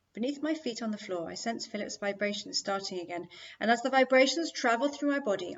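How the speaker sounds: ordinary delivery, nothing marked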